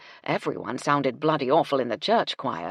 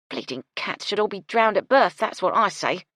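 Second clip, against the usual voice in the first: plain reading voice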